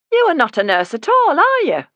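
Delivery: high, loud Yorkshire accusation; mother accuses the fake nurse, high and loud; suspicious accusation. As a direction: call out the liar. Woman accuses the visitor.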